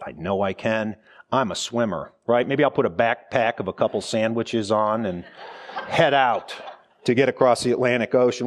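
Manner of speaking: usual speaking voice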